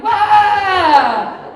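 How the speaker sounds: loud high yelp; pained yelp. Acted. Comic grabbing a Theragun by mistake, loud yelp. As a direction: yelp from the sudden jolt.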